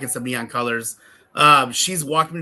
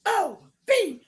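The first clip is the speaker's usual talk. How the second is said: put-on little girl's promo. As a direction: mimic a small child pausing between each letter. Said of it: "And then she goes".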